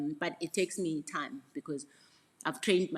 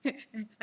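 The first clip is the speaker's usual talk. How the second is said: long, rolling high laugh; drawn-out giggle; amused laugh; 7 semitones higher, 4 dB louder than usual. Real, not acted. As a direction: laugh heartily at your own habit. Amused by her delayed comeback.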